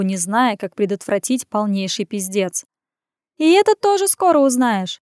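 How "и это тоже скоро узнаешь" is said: high, lilting call back; teasing evasive woman. A narrator's reading. Woman leaving down the corridor, airily evasive. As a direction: answer airily over the shoulder. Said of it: "отозвалась она".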